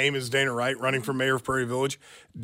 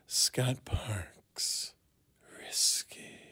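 breathy political ad whisper; 8 dB quieter, much slower than usual. Pretend. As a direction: hush it like a sinister attack ad. Mock political attack ad.

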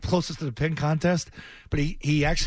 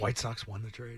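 quiet repeat; 4 semitones lower, 9 dB quieter, slower than usual. Retold or imitated. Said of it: "I'm doing my Felger take".

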